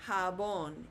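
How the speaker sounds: "jabón" as ha-BON, J said as H; teacher's careful model of "jabón"; Spanish pronunciation. Shown as a demonstration. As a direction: say "jabón" with an H start and stressed last syllable. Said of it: "is pronounced".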